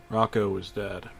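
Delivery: ordinary delivery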